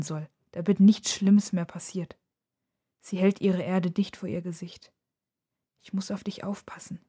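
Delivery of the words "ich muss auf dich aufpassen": very soft, hushed whisper. A narrator's reading. Nora talks to the globe held close, whispering. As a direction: barely voice it, just a whisper. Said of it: "wispert sie".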